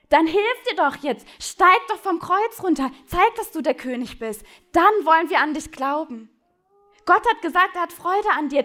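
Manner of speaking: loud, high jeering taunts; scornful shouting crowd, rising to a yell; mocking crowd. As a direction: sneer up at the man on the cross. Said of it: "spotten über ihn und sagen".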